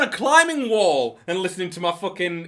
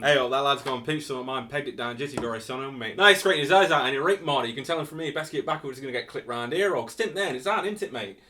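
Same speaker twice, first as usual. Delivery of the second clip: Nottingham test sentence; 4 semitones lower, 3 dB quieter, faster than usual. Shown as a demonstration. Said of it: "see how Nottingham you are".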